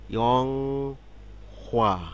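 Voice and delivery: ইয়ংহোয়া drawn out very slowly, each syllable held; slow model word. Shown as a demonstration. Teacher stretches ইয়ংহোয়া for students to copy. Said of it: "teacher models Korean word".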